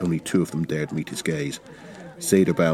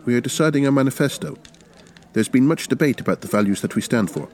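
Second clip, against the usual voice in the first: lightly raised, earnest revolutionary; a higher-pitched, persuasive comrade; Zederbaum's voice; 7 semitones higher than usual. A narrator's reading.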